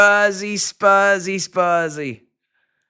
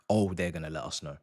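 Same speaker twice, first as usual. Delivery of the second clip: normal talking voice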